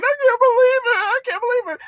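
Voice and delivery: high whiny fake sob; mock crying. Mocking the crying politician, in a high fake sob. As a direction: whine it like crocodile tears. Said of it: "She was sitting up there crying".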